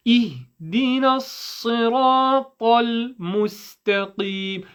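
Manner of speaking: long held vowels, each letter distinct; crystal-clear recitation. Shown as a demonstration. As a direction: recite with active effort on every sound. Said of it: "every sound that you make is crystal clear".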